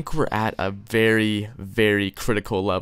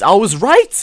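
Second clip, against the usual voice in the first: louder, higher gloat; triumphant; 9 semitones higher, 10 dB louder than usual. Real, not acted. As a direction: crow about being right. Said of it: "never doubt me".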